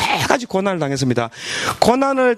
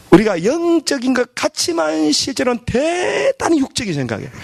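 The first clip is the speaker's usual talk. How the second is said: preaching shout; 6 semitones higher than usual. Real, not acted. Preacher building to climax.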